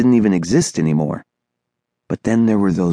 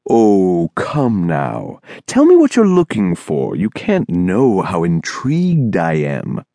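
coaxing singsong; slower than usual. Acted. Thin man pressing the boy to tell what he seeks, coaxing. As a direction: coax him with eerie charm.

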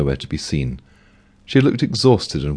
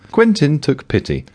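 usual speaking voice

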